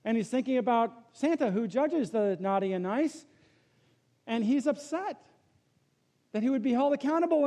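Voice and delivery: normal talking voice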